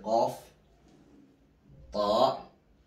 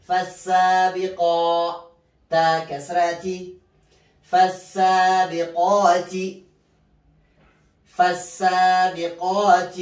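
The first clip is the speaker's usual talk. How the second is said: slow chanted tajweed recitation, spelling each letter; Quran recitation drill; 9 semitones higher, 12 dB louder than usual. Shown as a demonstration. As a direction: chant the letters and the word for students to repeat. (ترتيل تعليمي بطيء مع تهجئة الحروف)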